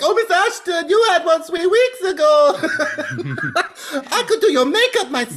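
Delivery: high laughing put-on French voice; mock French accent. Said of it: "She's like".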